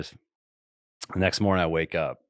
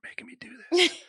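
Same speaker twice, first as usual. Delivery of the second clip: retold hushed whisper to her; 4 dB quieter, faster than usual. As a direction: whisper it so the cameras can't hear. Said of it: "whisper to her 'cause the cameras were behind me".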